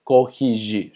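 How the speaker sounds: corrigir said slowly, "ko-hee-ZHEER", rr as h and g as zh; Portuguese double R. Shown as a demonstration. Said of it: "se pronuncia G".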